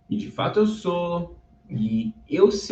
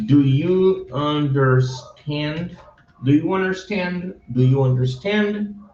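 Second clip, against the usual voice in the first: "Do you understand?" read slowly and clearly; slow teacher read. Shown as a demonstration. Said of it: "reading demo".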